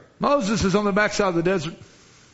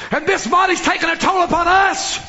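full-force preaching; 8 semitones higher than usual. Acted. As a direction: build it like a revival peak, voice ringing. Preacher calls for deliverance.

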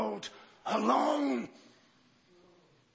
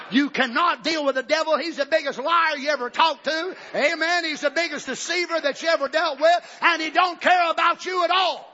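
loud, higher shouted warning; full-force preaching; 8 semitones higher, 5 dB louder than usual. Acted. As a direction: hammer the warning home.

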